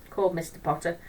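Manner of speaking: usual speaking voice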